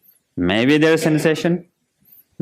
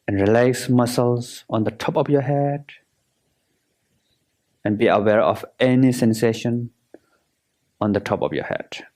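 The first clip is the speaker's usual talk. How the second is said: guided meditation. Acted. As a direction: guide them through relaxing, unhurried and measured.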